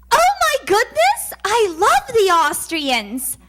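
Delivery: high excited squeal, then drawn out; gushing admirer. Acted. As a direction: gush like a delighted fan. Gushing American mishears Australia.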